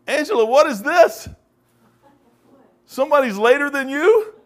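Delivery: usual speaking voice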